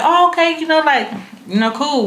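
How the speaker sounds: high, caught-off-guard okay; taken-aback politeness; awkward surprise. Retold or imitated. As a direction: go along with it, startled. Speaker awkwardly responding to friend.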